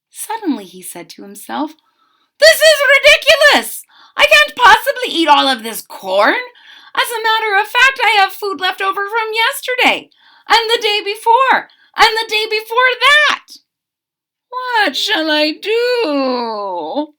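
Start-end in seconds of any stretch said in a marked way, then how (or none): 2.4-13.5 high, loud, fed-up outcry; exasperated exclaim. A narrator's reading. Cook shouting at his own leftover corn, exasperated and loud. 14.5-17.1 slow, crying plea; despairing wail. A narrator's reading. Cook wailing over too much food, slow and crying. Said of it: "Mister Angelo wondered".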